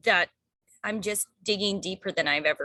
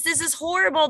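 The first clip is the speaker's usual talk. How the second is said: higher and louder, imitating a panicked friend; hammed-up dismay, voice pitched up; mock alarm; 9 semitones higher, 5 dB louder than usual. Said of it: "A lot of people are like".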